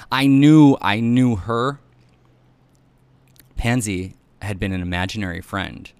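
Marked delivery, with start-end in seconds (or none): 0.1-1.8 loud, higher, drawn-out cry of recognition; triumphant exclamation. A narrator's reading. Woman finally places the dream figure, exclaiming loudly. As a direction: exclaim it slowly as memory clicks.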